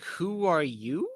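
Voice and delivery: slow, high, drawn-out question; mock bewilderment. Player meeting an unknown character, baffled. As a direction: stretch it out, baffled, rising at the end.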